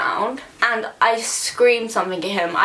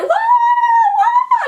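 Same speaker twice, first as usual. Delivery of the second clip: high, loud startled whoa; shocked yelling; 7 dB louder than usual. Retold or imitated. The crowd at the bus yells in alarm. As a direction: yell it like a panicked bystander. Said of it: "everyone's like".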